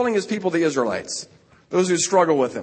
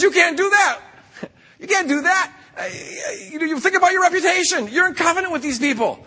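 much higher, pleading pushback; indignant protest; 9 semitones higher than usual. Acted. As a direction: push back hard on God, voice up high. Moses pushes back on God.

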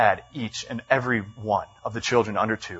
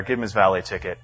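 normal talking voice

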